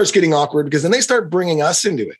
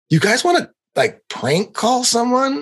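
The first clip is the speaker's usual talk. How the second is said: a playful higher mock invitation; mischievous invite; 7 semitones higher than usual. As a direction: tempt them like a prankster. Quoting comedian.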